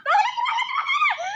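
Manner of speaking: put-on shrill panicked child pleading; panicked child scream. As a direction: scream for dad not to go. Child screams as father leaves.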